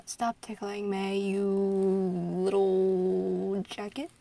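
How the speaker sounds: very drawn-out, searching mock insult; mock scolding. Scolding a jacket that tickles, drawn-out mock scolding. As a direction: stretch out the scolding while hunting for an insult.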